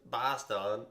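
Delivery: exaggerated accent on "Boston", flattened first vowel; put-on Boston accent, punched out; mock Boston accent. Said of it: "the way people say Boston".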